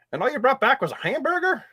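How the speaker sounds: bad put-on Southern accent, high; fake Southern accent. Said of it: "a really bad fake Southern accent".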